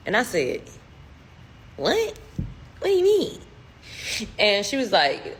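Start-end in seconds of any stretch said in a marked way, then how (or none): 1.8-3.4 high-pitched, incredulous questioning; baffled disbelief. Retold or imitated. Teller reacts to the cryptic advice, high and baffled. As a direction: pitch jumps right up in bewilderment.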